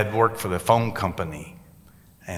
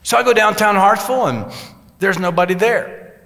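excited storytelling; 7 semitones higher, 3 dB louder than usual. Real, not acted. Excited retelling of empty town.

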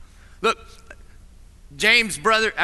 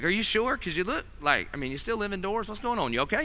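much softer, mock-worried probing; hesitant concern; 7 dB quieter than usual. Friends doubting someone looking a mess, hushed awkward concern. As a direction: imitate tactful, awkward checking on someone.

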